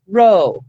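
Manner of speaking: "ro" said loud and on its own; syllable stressed. Shown as a demonstration. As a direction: punch out "ro" as a single syllable. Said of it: "Next syllable, syllable".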